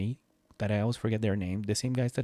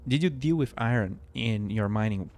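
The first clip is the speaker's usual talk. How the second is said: ordinary talk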